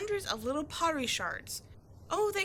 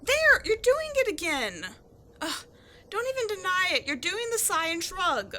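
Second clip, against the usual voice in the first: louder, higher, fed-up complaint; exasperated scolding; 6 semitones higher, 6 dB louder than usual. Acted. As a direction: call him out, exasperated. Scolding the partner's sigh and shrug.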